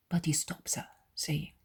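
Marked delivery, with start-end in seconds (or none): none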